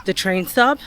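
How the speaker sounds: ordinary talk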